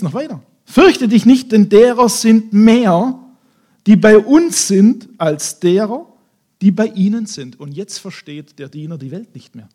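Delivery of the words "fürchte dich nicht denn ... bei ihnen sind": loud, higher, slow declaration; bold proclamation. Acted. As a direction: proclaim it with full confidence. Prophet proclaims to servant.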